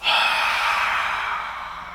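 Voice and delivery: long breathy exhale to fog a mirror; foggy exhale. Shown as a demonstration. Teacher shows students a breath that fogs a mirror, long exhale. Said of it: "fog up your mirror".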